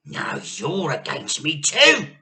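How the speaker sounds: put-on loud, aggrieved old woman; Grandma shouting. A narrator's reading. Grandma turns on her daughter, shouting. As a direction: hurl the accusation. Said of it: "shouted Grandma".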